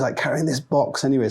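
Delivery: ordinary talk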